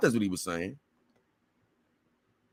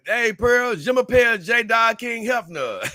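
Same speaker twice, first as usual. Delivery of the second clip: mock French; 9 semitones higher, 4 dB louder than usual. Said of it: "I took French at one point".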